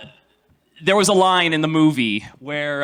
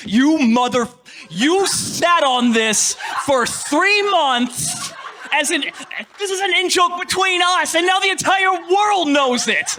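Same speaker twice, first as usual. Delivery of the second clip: mock outrage; 12 semitones higher, slower than usual. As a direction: play the betrayed buddy ranting. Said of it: "He's just like".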